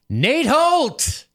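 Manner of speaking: high, hyped-up name shout; Steve Holt shout. Acted. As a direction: holler the name like a catchphrase. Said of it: "you should say it like Steve Holt".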